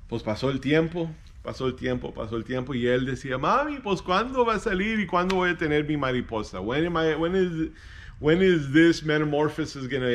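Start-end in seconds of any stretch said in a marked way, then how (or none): 3.4-10.1 high, eager pleading; a kid who can't wait any longer; impatient child. Acted. Boy begging mother to know when, impatient.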